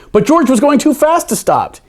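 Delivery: usual speaking voice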